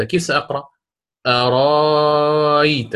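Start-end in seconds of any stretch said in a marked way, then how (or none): 1.3-2.9 أرأيت with the madd stretched very long; full madd. Shown as a demonstration. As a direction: hold the long vowel of أرأيت fully. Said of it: "بالإشباع".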